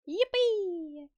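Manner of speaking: high, drawn-out squeal; little cheer. Real, not acted. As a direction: pitch it way up in a playful whoop. Cheering at a viewer coming back.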